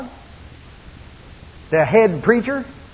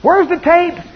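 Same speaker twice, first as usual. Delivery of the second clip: high, loud acted eagerness; mock-eager demand from the pews; eager congregation; 10 semitones higher, 5 dB louder, slower than usual. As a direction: call out like an excited churchgoer. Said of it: "We're going".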